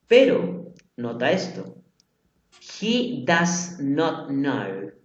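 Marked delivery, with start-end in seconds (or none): 2.8-4.9 very slow, word by word; correct sentence. Shown as a demonstration. Teacher contrasting the right form with the wrong one, slowly. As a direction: read it slowly so every word lands. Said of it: "pero no sería he does not knows".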